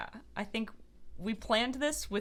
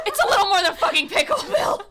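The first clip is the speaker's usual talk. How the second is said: high, loud indignant outburst; acted frantic shout with a laugh; exasperated yell; 10 semitones higher, 12 dB louder than usual. Frantic mother snaps at Bill.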